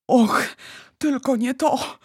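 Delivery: high-pitched cry of dismay. A narrator's reading. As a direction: cry out in alarm. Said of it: "krzyknął".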